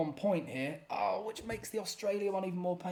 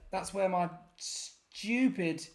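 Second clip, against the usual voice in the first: normal talking voice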